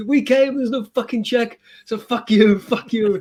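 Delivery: high, loud mock yell; mock shouting back. Hero yelling at the exterminator, hammed-up high shout. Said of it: "I go".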